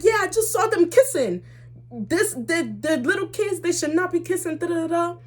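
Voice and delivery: high-pitched mock mom rant; scolding mom. Mom calling the boy's mother to complain, indignant.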